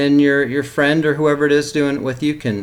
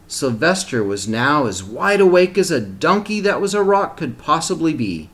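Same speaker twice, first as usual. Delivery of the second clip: plain reading voice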